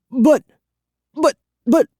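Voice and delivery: very high, loud stammer; panicked sputter. A narrator's reading. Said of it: "sputtering again".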